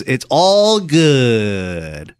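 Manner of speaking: very slow, stretched-out imitation of the guy; a slow, spaced-out mimic of a stranger; slow drawn-out. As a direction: drag each word out lazily like the homeless guy. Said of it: "That's how he said it".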